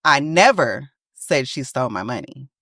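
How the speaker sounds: never pushed up and stretched; stress on never. Shown as a demonstration. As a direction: hit the word never hard. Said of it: "based on the emphasis that you are putting".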